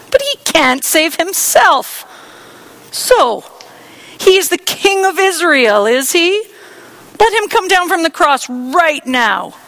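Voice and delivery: high, loud sarcastic jeering; mocking. Priests mocking Jesus on the cross, sarcastic jeer. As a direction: rub in the taunt. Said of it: "the elders also mocked Jesus".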